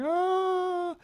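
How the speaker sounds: put-on high wailing cry of an old monk; anguished wail. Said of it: "Saint Augustine just crying".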